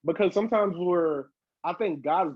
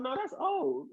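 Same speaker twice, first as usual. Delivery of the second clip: dismissive reply; 12 semitones higher, 6 dB quieter, slower than usual. Retold or imitated.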